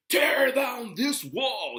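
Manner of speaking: slow, loud, high-pitched speech call in Reagan's voice; imitating Reagan. Lecturer quoting Reagan to Gorbachev, loud and drawn out. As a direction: declaim it like a president at the wall. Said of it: "And then Reagan".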